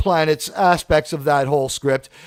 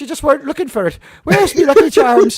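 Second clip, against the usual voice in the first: high-pitched comic Irish brogue, building louder; Irish accent; 6 semitones higher, 4 dB louder than usual. Acted. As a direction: play an Irish leprechaun for laughs. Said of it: "It's like you've become Irish".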